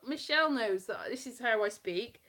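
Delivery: ordinary talk, nothing marked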